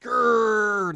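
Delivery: high loud hammed-up growl; silly high growl; mock growl. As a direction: growl like a cartoon villain.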